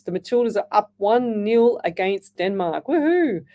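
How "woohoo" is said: high, louder swooping woohoo; gleeful little cheer. Real, not acted. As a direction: celebrate the score with a whoop. Cheering team's lead on air.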